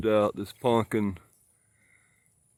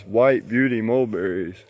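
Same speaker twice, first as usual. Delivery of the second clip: usual speaking voice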